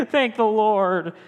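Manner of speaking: high, slow mock-relieved exhale. Derek voicing his relief that his brother isn't mad, drawn-out high relief. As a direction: let the relief pour out. Relief after fear passes.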